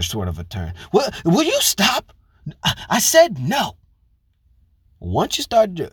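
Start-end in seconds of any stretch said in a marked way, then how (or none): 0.9-3.8 higher and louder, slow mock snapping; mock yell. Mimicking woman yelling at husband, slow mock snap. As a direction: imitate a wife snapping at her man. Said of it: "you yelled at him like that".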